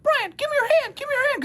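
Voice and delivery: put-on very high child voice, urgent; a little boy reaching out to help; eight-year-old kid voice. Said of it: "doing my best eight-year-old impression".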